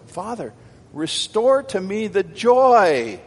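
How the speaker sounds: preacher acting a penitent's plea; pleading prayer. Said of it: "he came pleading for".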